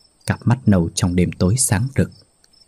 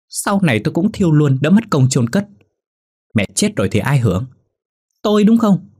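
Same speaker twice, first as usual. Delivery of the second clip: a son shouting down his mother over selling the land; angry yelling; 7 semitones higher, faster than usual. A narrator's reading. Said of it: "Phát gào lên".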